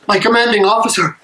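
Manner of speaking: ordinary delivery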